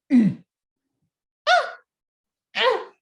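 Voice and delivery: wife tugging hard; straining grunts. Acted. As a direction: heave and strain at the stuck turnip. Family pulling.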